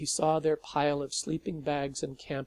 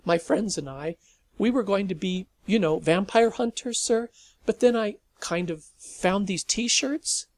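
put-on eager nervous teen; 7 semitones higher, 8 dB louder than usual.

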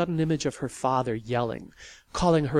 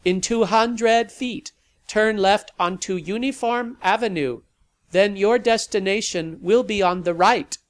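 GPS voice; 7 semitones higher, 7 dB louder than usual. Acted. Sat nav announcing the turn, robotic. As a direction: read it like a machine. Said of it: "The GPS rasped".

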